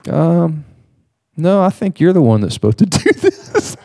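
pause, then gently correct, breaking into a laugh; hesitant retold correction. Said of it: "he just pauses".

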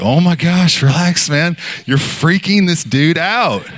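high-pitched exasperated plea, imitated; retold alarm at a friend's blunder. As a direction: tell him off, half-pleading. Friend scolds the panicking one.

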